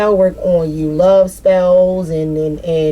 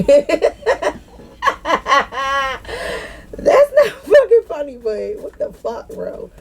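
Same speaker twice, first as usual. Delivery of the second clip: high squeal broken up by laughter; laughing protest; 7 semitones higher than usual. Real, not acted. Laughing at herself mid reading, high giggling protest. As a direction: protest through real giggles. Laughing at her own reaction.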